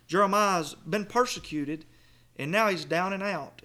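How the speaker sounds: usual speaking voice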